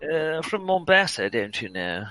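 put-on slow, posh upper class English accent. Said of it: "closer to standard upper class English".

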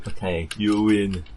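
slow, mock Finnish accent. Storyteller voicing the Finn who concedes, Finnish accent. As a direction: put on a Finnish accent, slowly. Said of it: "That was a Finnish accent".

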